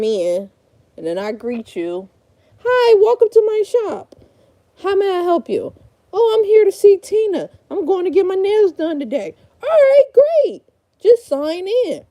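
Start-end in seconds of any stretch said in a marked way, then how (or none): 2.6-5.7 high, bright-eyed front-desk greeting; receptionist voice. Pretend. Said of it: "little reenactment". 6.1-9.3 high, chirpy customer checking in; client voice. Pretend. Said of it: "little reenactment". 9.6-12.0 high, bright-eyed front-desk greeting; receptionist voice. Pretend. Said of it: "little reenactment".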